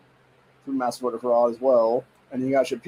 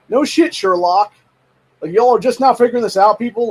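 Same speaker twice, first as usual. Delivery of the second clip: exasperated outburst; 7 semitones higher, 5 dB louder than usual. Real, not acted. Ranting to viewers about belated realization, exasperated and loud. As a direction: bark it out in fed-up disbelief.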